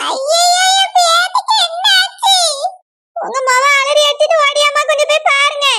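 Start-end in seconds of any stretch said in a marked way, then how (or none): 0.1-2.3 slow, high-pitched wail; anguished wail. Acted. Grandmother crying out for her granddaughter, wailing. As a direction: draw out a panicked lament.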